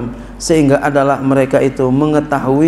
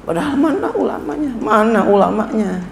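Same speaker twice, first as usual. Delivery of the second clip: mocking laugh; 7 semitones higher than usual. Real, not acted. Mocking so-called scholars.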